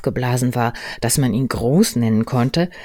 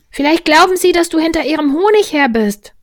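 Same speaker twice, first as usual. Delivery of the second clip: put-on very high, loud child's voice; little child; 9 dB louder than usual. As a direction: call up a helpful guess. Christopher Robin answers from below. (sehr hohe, laute Kinderstimme)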